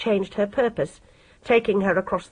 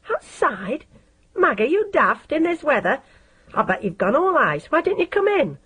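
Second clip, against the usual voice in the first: put-on incredulous boy; 6 semitones higher than usual. A narrator's reading. Boy incredulous at her waiting.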